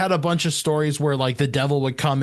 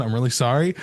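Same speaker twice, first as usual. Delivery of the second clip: ordinary talk, nothing marked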